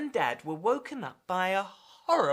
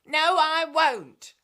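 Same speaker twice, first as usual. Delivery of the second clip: slow, higher, louder refusal; defiant refusal; 8 semitones higher, 7 dB louder, slower than usual. Acted. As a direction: dig in and refuse loudly. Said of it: "said Jessie".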